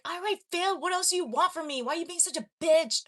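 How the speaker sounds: high, loud, fast retort; loud, heated outburst. Retold or imitated. Teen yelling back at mom after failing the test, heated outburst. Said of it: "voice getting loud".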